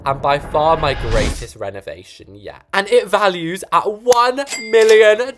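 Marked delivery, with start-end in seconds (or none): 2.7-5.3 proud announcement. Pretend. Announcing the price.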